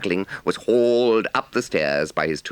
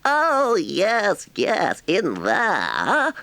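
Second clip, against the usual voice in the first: impatient Toad; 4 dB louder, much slower than usual. A narrator's reading. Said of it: "said Toad impatiently".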